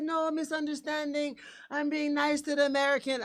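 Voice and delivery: high mock Italian-accent excuse; smooth Italian-accent excuse. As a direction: imitate the Italian pickpocket lying to the cop. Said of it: "talk to me with this accent".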